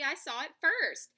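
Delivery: yelling. A narrator's reading. Squirrel insisting on first claim to the nut, yelling. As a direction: yell it like a squabbling rival. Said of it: "yelled Hickory".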